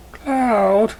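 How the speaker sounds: calling his name high across a distance; high call after a friend. Acted. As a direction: shout to stop him leaving. She calls after him.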